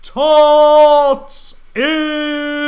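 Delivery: drawn-out announcement. Pretend. Host announcing tots in space, grandly drawn out. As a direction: proclaim it like a show title, stretched out.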